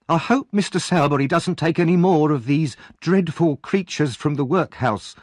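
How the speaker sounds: put-on high, sniffy young woman; disdainful maid. Charlotte complains to Missus Sowerby about workhouse boys, high and sniffy. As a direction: look down your nose at workhouse boys.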